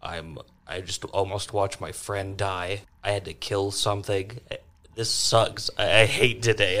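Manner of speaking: ordinary talk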